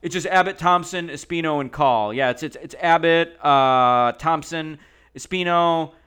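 imitating someone repeating a list over and over; Nats Pud. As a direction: reel off the names loudly and insistently. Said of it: "Nats Pud kept going".

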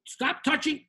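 usual speaking voice

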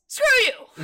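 mock old man brushing someone off, laughing; grumpy old man. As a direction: toss it off like a cranky old neighbor, half laughing. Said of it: "the old man goes".

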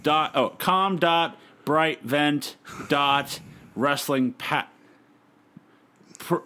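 slow, careful backwards reading; slow backwards recital. Shown as a demonstration. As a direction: spell it out slowly in reverse. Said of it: "Say it backwards".

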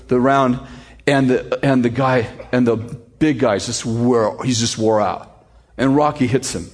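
ordinary talk

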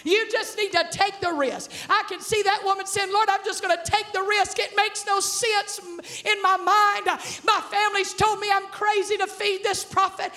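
high-pitched, driving sermon delivery; full-force preaching. Real, not acted. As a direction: preach the story with mounting excitement.

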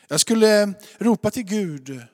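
jag ropar called out loud; a preacher's loud call; loud cry. Acted. Preacher crying out.

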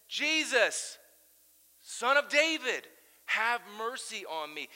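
high, loud, slow cry of "Jesus, Son of David"; yelling out. Retold or imitated. Bartimaeus yelling out to Jesus, loud and pleading. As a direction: cry out for mercy. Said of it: "When he was yelling out to Jesus".

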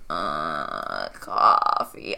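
deep croaking groan; low breathy groan of a word; groggy groan. Acted. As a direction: croak it out like you just woke up. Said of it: "so she goes".

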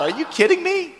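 can't believe what he's hearing, half laughing; incredulous Moses. Retold or imitated. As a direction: Moses stunned that the Egyptians will pay them to leave, incredulous. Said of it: "Moses might have laughed out loud".

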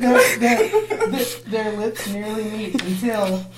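stumbling over words while laughing; trying to read through the giggles. Real, not acted. Narrator cracking up mid-sentence.

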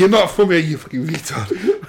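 mock insult. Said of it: "That's what Angle wanted to say".

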